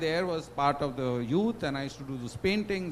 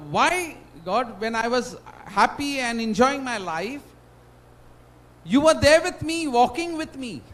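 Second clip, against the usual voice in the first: louder and higher, crying out to God; anguished plea; 6 semitones higher, 7 dB louder than usual. Acted. Man retelling his plea to God, loud and anguished. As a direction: plead with God, hurt and rising.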